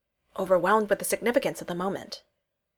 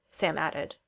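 usual speaking voice

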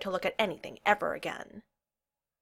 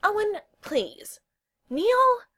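very high-pitched plea; begging; 7 dB louder, much slower than usual. A narrator's reading. Kel turns to Owen and Neil, begging. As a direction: appeal to each friend in turn, high and desperate. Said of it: "Kel all but begged".

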